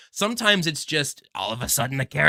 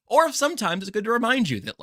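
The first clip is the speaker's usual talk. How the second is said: ordinary talk, nothing marked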